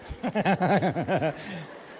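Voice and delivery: real chuckle at own joke; rolling laugh, trailing away. Real, not acted. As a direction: chuckle along after the punchline.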